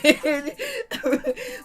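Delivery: high-pitched fit of giggles; helpless laugh. Real, not acted. Host tickled by "don't do it", helplessly laughing. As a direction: crack up in a long, high laugh. Cracking up at comments.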